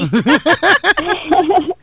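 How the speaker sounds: high, loud cackling laugh; bright burst of laughter. Real, not acted. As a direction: laugh at your own punchline. Host laughs at her own joke.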